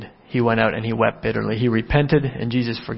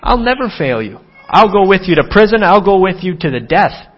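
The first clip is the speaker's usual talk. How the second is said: rash boast; 5 semitones higher, 5 dB louder than usual. Acted. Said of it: "he had rashly and pridefully said".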